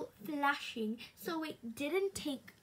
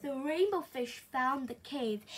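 usual speaking voice

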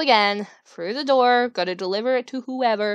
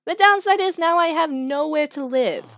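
usual speaking voice

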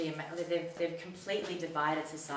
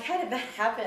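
higher, louder, stunned question; shocked disbelief; 7 semitones higher, 8 dB louder than usual. Retold or imitated. Seeing the Nazi flag, louder shocked disbelief. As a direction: blurt it out in disbelief.

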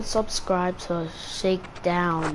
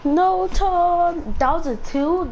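frustrated shout at Tom; 8 semitones higher, 4 dB louder than usual. Real, not acted. Yelling at teammate during game.

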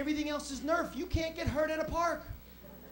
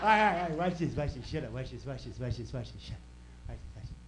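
Joe Pesci; 13 semitones lower, faster than usual. Retold or imitated. Brother hushing before he yells, Joe Pesci voice. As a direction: repeat it like a scheming tough guy, dropping very low. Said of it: "He talked like Joe Pesci since I was seven".

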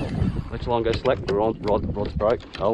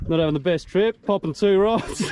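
laughing; 6 semitones higher than usual. Real, not acted. Mate snapped two rods.

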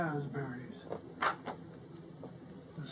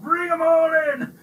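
loud, high roar of excitement; 8 semitones higher, 9 dB louder than usual. A narrator's reading. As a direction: roar the orders with glee.

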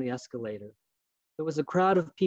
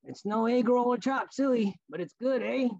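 raised, overly clear voice; 9 semitones higher than usual. Retold or imitated. Store man offers the boy a Kit Kat, high and over-clear. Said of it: "He raised his voice like I could not hear him".

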